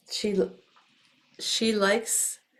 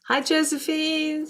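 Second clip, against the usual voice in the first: bright greeting; 9 semitones higher, slower than usual. Real, not acted. Greeting a viewer.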